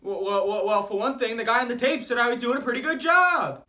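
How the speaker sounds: student yelling back that he'll get the part; defiant shouting. Real, not acted. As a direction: argue back loudly. Defiant argument with teacher.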